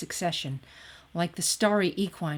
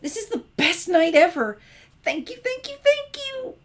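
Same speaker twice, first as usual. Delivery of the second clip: much higher and louder, squealing with joy; ecstatic; 7 dB louder than usual. A narrator's reading. Twilight squealing to Sunset about the meteor outburst, ecstatic and much higher and louder. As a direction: shriek with delight at the meteors.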